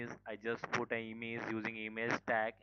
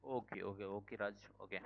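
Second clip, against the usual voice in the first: ordinary delivery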